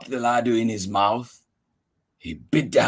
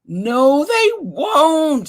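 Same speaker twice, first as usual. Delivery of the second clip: mouse voice; 13 semitones higher, 4 dB louder, much slower than usual. Acted. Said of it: "said the mouse".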